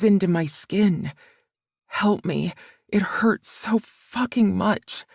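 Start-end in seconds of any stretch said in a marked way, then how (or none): none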